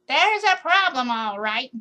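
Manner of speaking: put-on high, loud scratchy old tree; a scratchy voice insisting. A narrator's reading. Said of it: "a scratchy kind of voice say".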